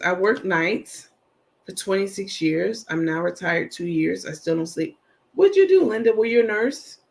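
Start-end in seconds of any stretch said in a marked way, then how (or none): 5.3-7.0 high, loud burst of curiosity; eager shout-out to a viewer; excited question. Real, not acted.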